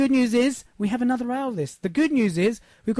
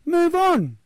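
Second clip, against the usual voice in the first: rising push; 10 semitones higher, 4 dB louder than usual. Real, not acted. Motivational push.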